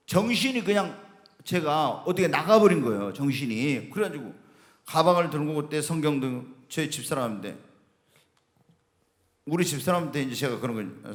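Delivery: ordinary delivery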